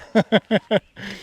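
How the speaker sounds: rapid chuckle; tickled country-boy chuckle. Acted. Tickled by his favorite part.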